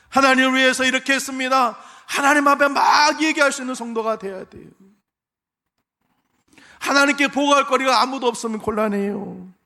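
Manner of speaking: loud, high, driving sermon voice; fiery preaching. Acted. Pastor exhorting the congregation at full force. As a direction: preach it to the rafters.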